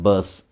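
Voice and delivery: बस said with a short "a", as "bas"; demo of बस. Shown as a demonstration. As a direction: sound it out for the class. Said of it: "क्या sound कर रहा है".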